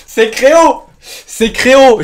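high, loud, certain cry; excited accusation. Real, not acted. Convinced a friend is trolling him.

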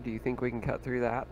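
ordinary delivery

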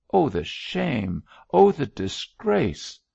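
anguished lament. A narrator's reading. The bishop confessing to the doctor, slow anguished crying out. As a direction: let the grief swell until it breaks.

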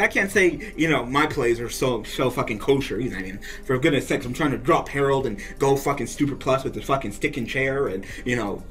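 usual speaking voice

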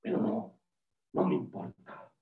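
very low, soft hunter grumble; sulky whale hunter. Acted. Hunter sulks, resolves to continue.

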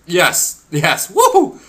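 high, loud whoop of victory; real joyful shout; excited cheer. Real, not acted. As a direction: cheer like you just won big. Winning the gamble minigame.

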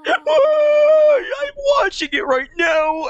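very high, drawn-out mock wail; sob-shout it as a hammed-up cartoon crybaby; high, exaggerated fake crying; role-played crying fan character. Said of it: "why am I role-playing as him now?".

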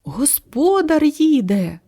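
high, stretched warning cry. A narrator's reading. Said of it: "крикнув ворон".

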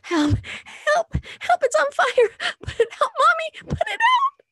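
put-on high, loud panicked child's cry; frightened little girl screaming from a nightmare; terrified child screaming. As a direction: scream for Mommy in pure terror. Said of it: "She woke me up screaming".

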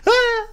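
high, distant wail; a long cry dropping into a pit; creature's falling cry. Acted. Game master shows the troll's fall without a poof, high wail. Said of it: "you hear the roar of the creature as it just falls".

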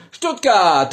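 loud, high shout. Acted. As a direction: yell the city name. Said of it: "warum ich so schreie immer".

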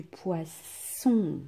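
poisson slowly with a long hissed "sss"; stretched S sound. Shown as a demonstration. Teacher confirms the right word for pupils, S drawn out. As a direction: linger on the ss of poisson. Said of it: "les deux S qui sont plus forts".

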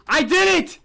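high, loud victory cheer; triumphant yelling. Real, not acted. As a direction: shout with relief at escaping. Escapes the maze.

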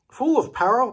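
plain reading voice

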